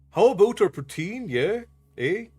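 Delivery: put-on Canadian accent with a questioning lift. Shown as a demonstration. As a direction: do a Canadian accent that drifts toward Irish. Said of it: "Your Canadian accent, eh, has an Irish flair".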